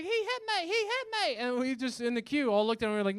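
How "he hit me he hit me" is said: put-on high-pitched little English kid voice; whining British child. Child tattling on the bus driver, high whine. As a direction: squeak it out as a lying child's complaint. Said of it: "And so he's like".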